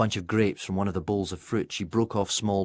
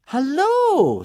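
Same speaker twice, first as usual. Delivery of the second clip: much higher, louder eager hello; excited greeting; 10 dB louder than usual. A narrator's reading. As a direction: greet the visitor with bright excitement. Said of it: "said Shanice excitedly".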